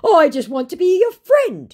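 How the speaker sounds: mock the scammer begging; imitating a whiny scammer; whiny mock plea.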